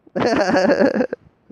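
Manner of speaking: long, loud, high laughter. Real, not acted. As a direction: crack up loudly. Laughs at own joke.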